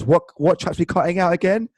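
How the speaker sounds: loud, high blurted question; shouted. Retold or imitated. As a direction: shout it across the room. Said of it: "Elias shouted".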